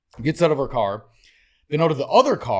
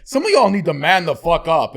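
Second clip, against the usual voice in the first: tough talk; 5 semitones higher, 7 dB louder than usual. Retold or imitated. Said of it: "And I'm like".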